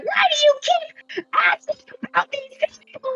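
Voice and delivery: very high, strained, close to tears; shrill panicked plea. Retold or imitated. Bart retelling his plea to the mushroom leader, high and upset. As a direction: squeak it out in distress. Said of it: "I was like".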